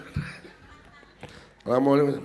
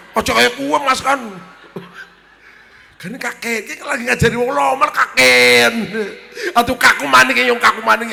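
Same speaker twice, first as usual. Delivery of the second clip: protesting loudly; 6 semitones higher, 7 dB louder than usual. Acted. Teasing argument over the price.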